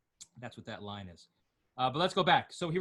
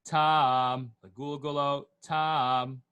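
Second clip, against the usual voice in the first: Legulgalo Tam with a held, sung Tam; stress on final TAM; 7 dB louder, much slower than usual. Shown as a demonstration. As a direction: set Tam apart and lean on it. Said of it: "And there's your line".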